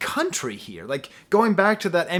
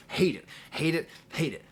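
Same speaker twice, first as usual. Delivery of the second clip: dramatic hate; 3 semitones higher than usual. Real, not acted. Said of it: "made me dramatically rip up".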